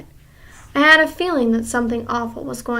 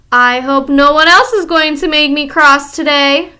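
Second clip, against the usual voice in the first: louder, cool witch threat; menacing remark; 3 semitones higher, 9 dB louder than usual. Acted. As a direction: warn them lightly. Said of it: "she remarked".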